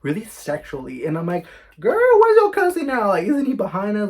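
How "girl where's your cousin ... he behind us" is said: high, loud disbelief; shocked. Retold or imitated. Asking the friend where the cousin is, shocked squeal.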